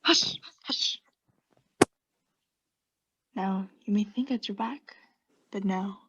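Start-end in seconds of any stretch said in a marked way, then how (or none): 0.0-1.0 urgent whispered hush; sharp hush. Acted. Narrator silencing the listener in an urgent whisper. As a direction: hush them fast and low.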